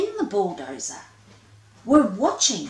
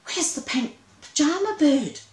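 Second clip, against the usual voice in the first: higher alarmed shout; 9 semitones higher, 4 dB louder than usual. Acted. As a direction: call out in sudden worry. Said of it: "gave a shout".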